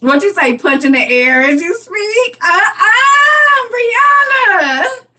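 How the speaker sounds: high, loud playful outrage; indignant shouting. Real, not acted. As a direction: call Brianna out, voice leaping up. Reacting to a viewer's comment.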